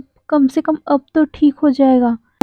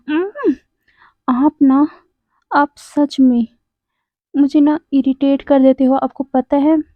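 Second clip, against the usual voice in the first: ordinary delivery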